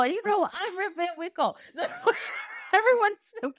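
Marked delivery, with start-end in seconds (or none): 0.3-1.6 high, softer comic comeback; retold bewildered counter-claim, close to laughing; original Rip. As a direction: answer back lightly in mock surprise. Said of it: "original Rip is, who are you?".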